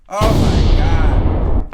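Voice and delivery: slow, loud, drawn-out "Oh my God"; exasperated groan. Real, not acted. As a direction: stretch it out in disbelief. Exasperated at fans' sympathy.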